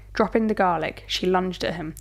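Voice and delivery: ordinary delivery, nothing marked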